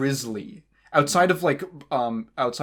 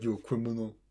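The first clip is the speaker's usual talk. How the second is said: the Rock impression; 6 dB quieter, faster than usual. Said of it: "is my rock impression".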